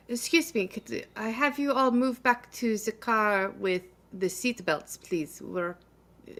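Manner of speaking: put-on a lightly French-accented attendant. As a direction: ask politely with a hint of French accent. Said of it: "I can't do a German accent. That was French".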